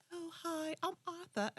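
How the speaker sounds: lightly done bad British accent. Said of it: "it's a British, so bad British accent".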